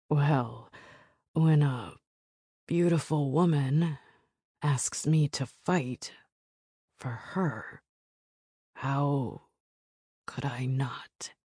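halting, with long pauses between phrases; slow and deliberate. A narrator's reading. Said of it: "His words are slow and deliberate".

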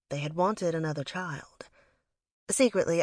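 ordinary delivery

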